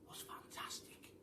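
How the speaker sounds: whispered in wonder; awed whisper. A narrator's reading. Narrator gazing at the new door, awed whisper. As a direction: breathe it out, amazed.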